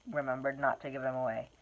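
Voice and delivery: plain reading voice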